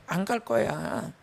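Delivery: slow, set-jaw refusal; stubborn refusal. Retold or imitated. Daughter-in-law refusing to go to the ancestral rite, slow and stubborn. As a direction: dig in and refuse flatly. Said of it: "얼마나 독해".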